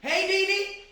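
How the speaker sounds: high, loud imitated holler; calling out. Friend waves to Dee Dee behind the tree, high hollered greeting. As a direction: hail someone from across the street. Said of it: "Marshalline waving like this".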